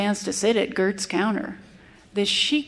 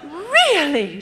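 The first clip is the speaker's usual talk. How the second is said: a startled, higher "Really?"; sputtered surprise; 6 semitones higher than usual. A narrator's reading. The Manhattan lady sputters at the cowboy, surprised. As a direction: sputter it, caught off guard. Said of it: "she managed to sputter".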